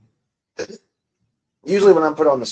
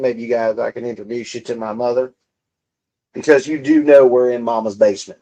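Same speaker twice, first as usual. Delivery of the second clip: normal talking voice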